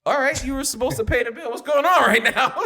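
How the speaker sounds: can't hold the laugh while arguing; laughing protest. Retold or imitated. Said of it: "I said".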